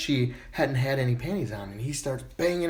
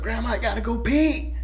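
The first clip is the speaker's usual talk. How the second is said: put-on kid yelling out; 9 semitones higher than usual. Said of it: "so he screams out".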